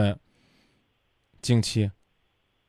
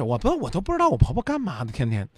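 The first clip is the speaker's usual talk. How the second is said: higher and faster, a sneering mimic of her complaint; mocking imitation of caller; 10 semitones higher than usual. Radio host mocking the caller's own words back at her, higher and fast. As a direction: parrot her words back in a high, quick mocking whine. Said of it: "你的原话是".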